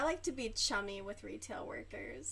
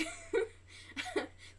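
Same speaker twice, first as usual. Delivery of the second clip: breathy laugh. Real, not acted. Laughing at own liquor store joke.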